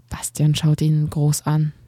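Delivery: plain reading voice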